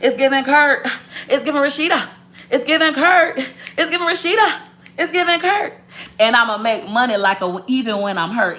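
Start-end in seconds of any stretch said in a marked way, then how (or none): 0.0-5.8 high singsong taunt, climbing with each repeat; mocking commenters. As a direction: chant it back at the haters, higher each time. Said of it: "Somebody talking about".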